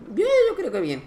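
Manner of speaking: mock high voice repeating his verdict; high-pitched repeat. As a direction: squeak it out in a high voice.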